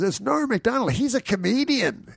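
very high proclamation; imitated host's reveal. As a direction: proclaim it to the whole room, hammed-up. Host announcing the reveal, retold.

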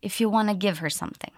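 fast, natural linked speech; naturally. Shown as a demonstration. Teacher showing the natural spoken version, fast. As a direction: run the words together quickly. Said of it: "then we'll do it naturally".

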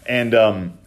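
normal talking voice

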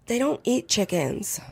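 slow, soft, flat correction; calmly deflate her panic; deadpan fiance. Retold or imitated. Fiance calmly telling her possums don't eat chickens, slow and soft. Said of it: "He goes".

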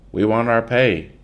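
angry worker demanding his pay. Acted. Striking workers demand their pay from Pa, angry.

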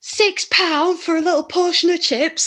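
high indignant northern complaint; high mock-outraged Yorkshire moan; outraged northerner. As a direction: squeal at the price in disbelief. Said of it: "you go, like".

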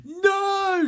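loud, high drawn-out scream. Acted. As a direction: scream NO with full force. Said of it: "she screams".

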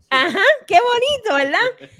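high, loud sarcastic scolding; sarcastic mom. Retold or imitated. Mom opening shower curtain on bleeding kid, loud sarcastic scold. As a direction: catch the kid red-handed with mock sweetness. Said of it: "cuando mami abre la cortina".

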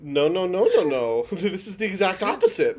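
laughing no no no; laughing protest. Retold or imitated. Mock objection with laughing.

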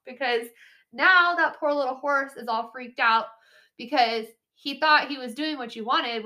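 ordinary talk, nothing marked

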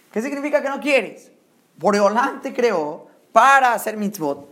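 ordinary talk, nothing marked

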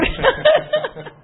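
short high giggle at herself; high laugh. Real, not acted. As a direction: laugh off the slip. Se ríe de su confusión. (risita aguda)

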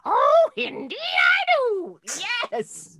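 very high, slow, loud witch shriek. Retold or imitated. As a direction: wail it out like a gleeful swamp hag. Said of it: "the way she is so funny".

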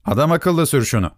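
high-pitched impatient nagging; angry hunchback. A narrator's reading. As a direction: goad the driver, voice climbing in pitch. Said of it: "Kambur kızarak". (kızgın, tiz sesle sıkıştırma)

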